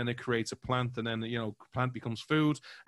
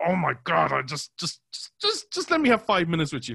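frustrated outburst; 5 semitones higher, 4 dB louder than usual. Retold or imitated. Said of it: "I get frustrated and I wanna go".

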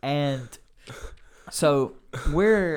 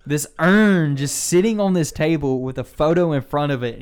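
usual speaking voice

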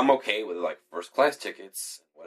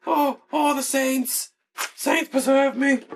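very high, hammed-up Irish wail; mock Irish accent; 4 dB louder, slower than usual. Irish passenger pleading to the saints, high mock Irish accent. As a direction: squeal it in a cartoon Irish accent.

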